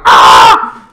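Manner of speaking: mock deep cry of pain; imitated pained scream. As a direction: roar as the fire bomb hits. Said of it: "It's like".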